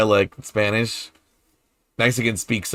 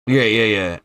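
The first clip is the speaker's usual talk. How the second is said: ordinary talk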